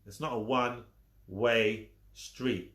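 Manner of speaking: slow emphatic not; slow repeat. Shown as a demonstration. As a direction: drag it out to hammer it home.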